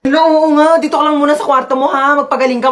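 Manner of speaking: ordinary talk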